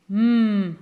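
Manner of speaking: M drawn out as a held mmm; held letter sound. Shown as a demonstration. Teacher to pupils, holding the M sound. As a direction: hum the M sound long. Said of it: "Ça se lit".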